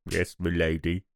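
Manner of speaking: a deferential butler murmuring; low chauffeur reply. Retold or imitated. Chauffeur answering the lady, low and soft.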